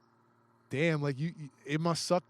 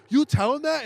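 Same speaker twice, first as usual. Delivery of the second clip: much higher and louder, disbelieving; shocked parents; 10 semitones higher, 7 dB louder than usual. Retold or imitated. Parents catching their daughter's lie, shocked question. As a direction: fire it back like stunned parents.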